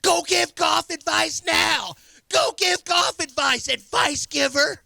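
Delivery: high, loud, drawn-out shouting at the TV; imitated screaming. Son screaming at the TV about the Texans, mimicked shout. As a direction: mimic a fan yelling slowly at the screen. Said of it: "Snacks is screaming at the T V".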